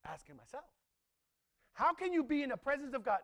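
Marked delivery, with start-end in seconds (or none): 1.7-3.2 louder, higher, pleading question; impassioned repeat. Shown as a demonstration. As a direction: press the question on them with fire. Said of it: "This is what I start asking myself".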